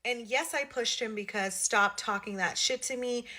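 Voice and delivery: ordinary delivery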